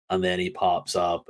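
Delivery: ordinary delivery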